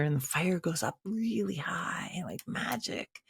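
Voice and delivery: whispered softly, full of wonder; hushed awe. A narrator's reading. Telling friends how the fire leapt up, in an awed whisper. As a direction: breathe it out like a secret.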